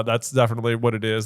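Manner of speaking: usual speaking voice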